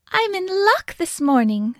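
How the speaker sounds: put-on high-pitched old woman, pleased with her luck; high storybook old-woman voice; delighted old woman. A narrator's reading.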